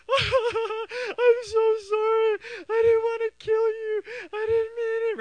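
high, loud, drawn-out wail; hammed-up sobbing apology; mock weeping. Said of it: "So I'm weeping".

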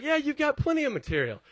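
airy friend waving the worry away; breezy brush-off. Retold or imitated. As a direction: toss it off like it's no big deal.

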